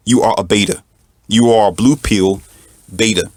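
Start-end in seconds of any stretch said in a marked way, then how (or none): none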